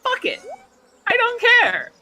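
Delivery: retelling giving up on not cursing, high mock exasperation. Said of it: "and I just said".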